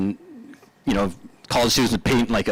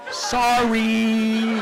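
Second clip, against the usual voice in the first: high, long-held singsong "sorry"; mock sheepish apology; 10 semitones higher than usual. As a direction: imitate a friend's drawn-out oops apology. Dan's drawn-out apology, retold.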